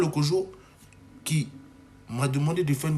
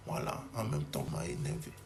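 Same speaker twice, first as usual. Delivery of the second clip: muttered annoyance; 5 semitones lower, 11 dB quieter than usual. Real, not acted. Closing annoyed aside.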